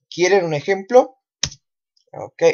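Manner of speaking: normal talking voice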